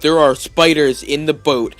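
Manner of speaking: slow, loud, each word of "There are spiders in the boat" driven home; "spiders" hit hard in a slow, exasperated answer; emphatic insistence. Retold or imitated.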